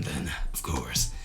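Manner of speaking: low, soft whisper to yourself; whispered aside. Acted. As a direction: mutter it under your breath. Muttered aside to himself.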